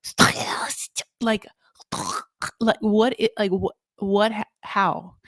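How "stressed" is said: whispered, breathy Stressed imitating Manon; Stressed breathed out unvoiced; imitating how Manon says it. Host copies Manon's way of saying Stressed, whispered. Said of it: "for how you say stressed".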